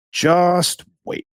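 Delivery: knowing warning. Retold or imitated. Telling buddy to wait.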